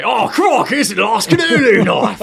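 high, loud hammed-up Australian accent; a teasing over-the-top Aussie twang to troll his wife; shouted mock Australian accent, loud and high. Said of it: "just to troll her".

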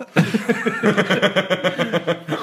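a sudden high laugh breaking off the reading; high burst of laughter. Real, not acted. Reader cracking up at the silly dialogue, a high laugh.

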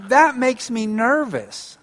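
slow and sincere, no blame; slow honest I statement. Shown as a demonstration. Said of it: "I statement that's less threatening".

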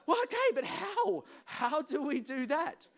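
high, loud mock-baffled plea; exasperated questioning. Churchgoer to preacher, exasperated questioning. Acted listener challenging the verse.

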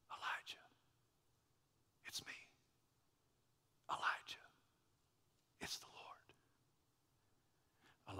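soft, unvoiced whisper of God. God whispering to Elijah at the cave, hushed whisper. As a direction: breathe it like God calling gently. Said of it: "recognized the whisper of God".

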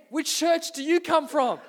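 "which church do you come from?" parroted back with a laugh; blundering repeat. Retold or imitated. Young pastor repeats the question, laughing at the blunder. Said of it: "and then I go".

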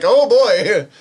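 loud, high mock "oh boy"; big comic oh-boy reaction; amused dismay. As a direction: react with laughing, exaggerated dismay. Acted reaction to child's question.

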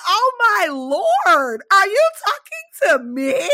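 retelling her shocked answer to the driver; outraged disbelief. Retold or imitated. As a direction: act out your shock at being cussed at. Retold outraged reply.

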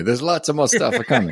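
put-on joking Italian accent with a laugh. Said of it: "I'm going to my Italian accent".

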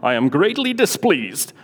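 prim British understatement; British version. Retold or imitated. Teacher mocking the translation, in a prim British accent. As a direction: say it like a stuffy Englishman. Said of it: "this sounds like the British version".

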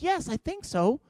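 high, soft, insistent reply; squeaky defensive. Retold or imitated. Husband blaming the Chihuahua to wife, squeaky defensiveness. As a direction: go up high, mock-defending a weak excuse.

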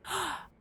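sharp high gasp; gasp of realisation. A narrator's reading. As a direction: gasp as it dawns on her. Lady realises.

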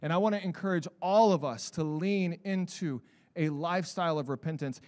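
usual speaking voice

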